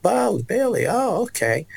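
high, light repeating of Bailey; repeating the name. Retold or imitated. The preacher repeating the boy's name back, light and high. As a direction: echo the child's name kindly. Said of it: "And she said".

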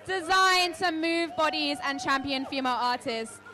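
loud, projected announcer read; stage introduction. Acted. Host introduces the next DJ to the audience, projected announcer style. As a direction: project the performer bio to a crowd.

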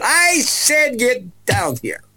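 high, loud, slow insistent command; commanding preacher. Retold or imitated. The preacher ordering the boy down, a loud insistent command. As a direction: demand it from the pulpit. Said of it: "And she said".

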